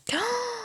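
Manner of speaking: high, quiet sharp intake; shocked gasp. Real, not acted. Artist seeing her old drawing, shocked gasp.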